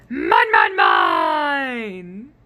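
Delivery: loud monster roar; roaring Minosaur. Acted. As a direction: bellow the final mine. Closing roar.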